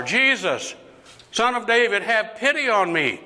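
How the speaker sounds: high-pitched pleading call, sustained to the end; insistent acted cry for pity. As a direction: call out harder, refusing to be silenced. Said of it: "he called out even louder".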